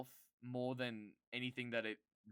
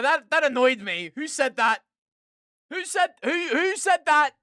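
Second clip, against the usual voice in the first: high, loud repeated yelling; screamed repeated demand; furious shouting; 12 semitones higher, 16 dB louder than usual. Real, not acted. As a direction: demand an answer at full volume.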